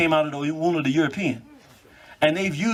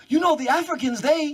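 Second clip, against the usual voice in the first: a raised, lecturing imitation of the woman; mocking the host; 10 semitones higher than usual. Retelling the woman who lectured him about Africans, mocking higher voice. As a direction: play her higher, preachy and sure of herself. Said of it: "she's actually telling me".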